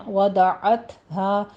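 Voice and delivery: وضعتها with the ain from mid-throat and breathy ta; وضعتها said very slowly, each sound separated; tajweed pronunciation. Shown as a demonstration. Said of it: "عین وسط حلق سے ادا کیجیے".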